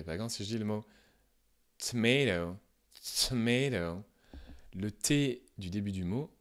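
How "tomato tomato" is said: tomato with a breathy, delayed T release; aspirated T. Shown as a demonstration. Teacher showing the aspirated American T, breathy release. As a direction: puff air after the T of tomato. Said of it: "un T qui est un petit peu aspiré".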